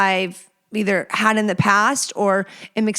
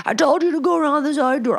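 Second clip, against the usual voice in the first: quick, higher mocking of an irate client; mock angry rant; 6 semitones higher, faster than usual. Client scolding the check-in girl, quick mock anger. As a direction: spit it out fast like a fussy regular.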